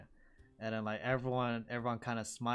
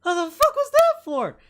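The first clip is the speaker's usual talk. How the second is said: high, loud pained shout; shocked howl after a smack to the face; outraged yelp; 15 dB louder than usual. Acted. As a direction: yell it after being slapped.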